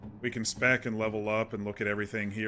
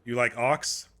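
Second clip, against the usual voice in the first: ordinary delivery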